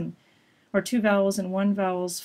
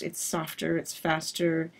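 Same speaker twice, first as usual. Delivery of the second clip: normal talking voice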